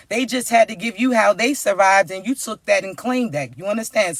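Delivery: ordinary delivery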